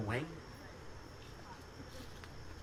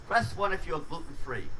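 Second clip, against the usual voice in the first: ordinary talk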